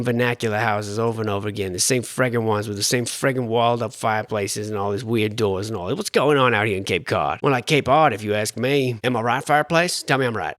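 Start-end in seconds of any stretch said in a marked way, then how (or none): none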